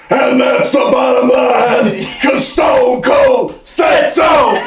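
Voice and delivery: Stone Cold catchphrase. Retold or imitated. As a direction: bellow it slowly like a wrestler.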